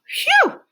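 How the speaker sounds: high, loud phew; relieved phew. Real, not acted. Teacher after a busy chapter, relieved phew. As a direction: blow out in relief.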